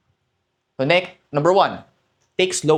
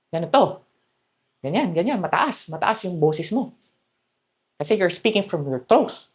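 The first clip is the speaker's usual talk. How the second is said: higher, thin throat voice; 5 semitones higher than usual. Shown as a demonstration. Coach showing the wrong throat voice, pitched high.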